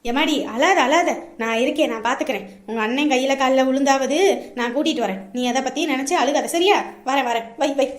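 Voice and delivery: calm a crying daughter; motherly consoling, promising help; reassuring. Acted.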